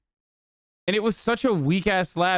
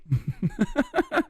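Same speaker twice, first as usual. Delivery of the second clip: high, rapid giggle; giggling laugh; 12 semitones higher than usual. Real, not acted. Streamer cracking up at own French bit, high giggle.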